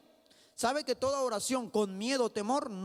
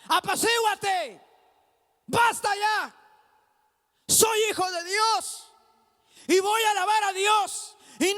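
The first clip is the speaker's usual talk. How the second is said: loud, high defiant rebuke of a problem; shouted command; 9 semitones higher, 8 dB louder than usual. Acted. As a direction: roar it as a believer ordering trouble away. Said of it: "le decimos al problema". (orden desafiante a gritos, voz aguda)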